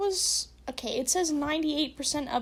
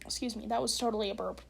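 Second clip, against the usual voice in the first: ordinary talk, nothing marked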